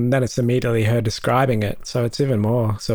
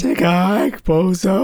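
put-on echoing the bratty kid; 9 semitones higher than usual. Echoing friend's movie quote.

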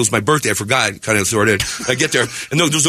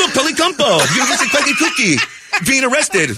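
mocking excitement; 10 semitones higher than usual. Mocking news headline.

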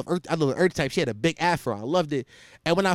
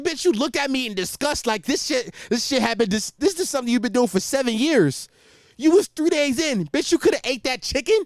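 higher-pitched indignant rant; retold anger; 6 semitones higher than usual. Boyfriend retelling his argument with his vegetarian girlfriend, indignant and higher. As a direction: call out the hypocrisy in exasperation. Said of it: "I was angry".

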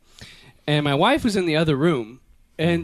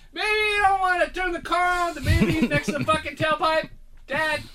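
wife voice; 9 semitones higher, 3 dB quieter than usual. Retold or imitated. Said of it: "my wife's like".

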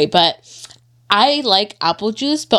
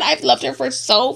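normal talking voice

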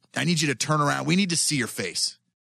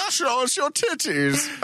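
laughing; 11 semitones higher, slower than usual. Acted. Joking call, laughing.

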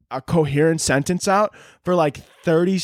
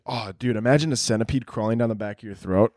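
usual speaking voice

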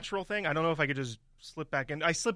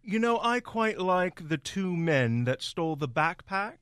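snobby British guy; 5 semitones higher than usual. Pretend. Improv guest talking about the backpack thieves, in a high snobby British voice. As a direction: look down your nose like a posh Brit. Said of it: "Why does everything become high-pitched?".

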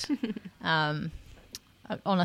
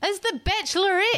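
teasing reveal; 12 semitones higher than usual. Real, not acted. Dropping news bomb.

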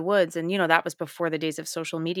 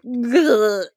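loud, higher-pitched exaggerated "ugh"; mock anguished groan; 6 semitones higher, 10 dB louder than usual. Actress replaying her reaction to the director's saddest-day note, mock anguished groan. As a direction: groan it out in comic mock despair.

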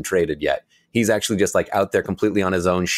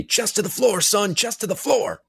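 barking gym coach; 13 semitones higher than usual. Retold or imitated. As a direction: bark it like a coach at practice. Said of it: "my high school basketball coach yelling me".

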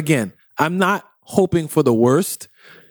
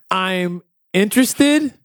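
slow, higher, weighing each word; wary, deliberate hedging; slow measured worry; 4 semitones higher, slower than usual. Real, not acted. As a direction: drag it out, torn between hope and dread.

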